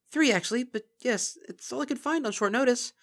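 high, hurried nervous pony voice; flustered Twilight. Acted. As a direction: defend the spoon sheepishly. Twilight explaining the spoon.

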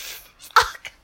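ordinary talk

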